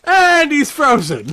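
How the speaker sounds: loud, high, drawn out into a laugh; slow singled-out call breaking into laughter; amused announcement. Real, not acted. Host tells listeners co-host froze, amused announcement.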